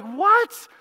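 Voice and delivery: high disbelieving yelp; sudden outraged what; shocked what. Retold or imitated. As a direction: leap up in pitch, stunned. Shocked at hour away.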